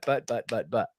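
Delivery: ordinary talk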